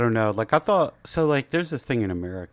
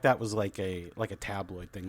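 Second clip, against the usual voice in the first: ordinary talk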